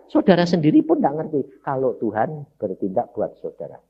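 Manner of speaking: ordinary talk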